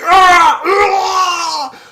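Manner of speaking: high, loud battle screams; hammed-up scream of someone being torn apart; mock agonized screams. Acts man being ripped apart.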